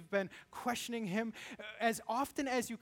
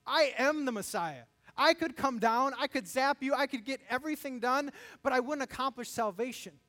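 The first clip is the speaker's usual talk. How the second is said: bold declaration; 5 semitones higher, 6 dB louder than usual. Acted. Voicing Jesus' inner response.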